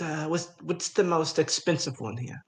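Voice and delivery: ordinary delivery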